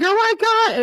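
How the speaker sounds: high-pitched retold alarm; mock panic. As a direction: relive the panic for laughs. Reliving panic at the table.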